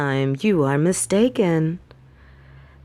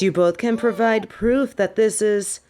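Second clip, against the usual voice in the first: ordinary delivery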